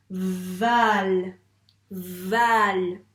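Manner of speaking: Val lancé fort et appuyé; syllable dictation. Shown as a demonstration. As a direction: marteler Val. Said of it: "on va écrire val". (syllabe Val dictée fort)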